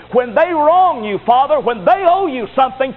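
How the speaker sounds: high, loud preacher's appeal; impassioned plea. Acted. Jesus interceding with the Father, high and impassioned. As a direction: draw out the plea with full force.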